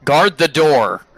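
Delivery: loud, high, snapping order at the guard; annoyed shout. Acted. Necromancer healer yells at intruders to guard the door. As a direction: bark him back to his post, slowing on the last words. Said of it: "looks up at you and goes".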